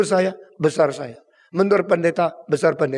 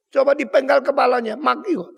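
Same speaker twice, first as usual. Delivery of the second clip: eager cruel urging; 10 semitones higher, 4 dB louder than usual. Acted. Herodias urging the killing.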